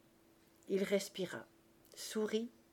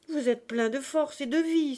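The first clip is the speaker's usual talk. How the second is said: loud, raised-pitch protest; exclaiming; 7 semitones higher, 8 dB louder than usual. A narrator's reading. Marius refusing the old man's death, exclaiming loudly. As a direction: cry it out with full conviction. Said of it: "s'écria Marius".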